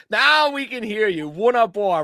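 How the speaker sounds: laughing relief, slowed; laughing as the guest unmutes. Real, not acted. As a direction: chuckle through the words.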